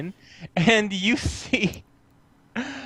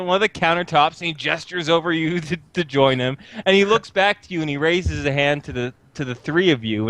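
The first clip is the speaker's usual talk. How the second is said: ordinary talk, nothing marked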